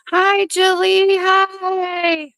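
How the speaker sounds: squeaky high hello to a viewer; high sing-song greeting. Real, not acted. Greeting Jilly in the live chat, high sing-song. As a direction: chirp it up high and slow.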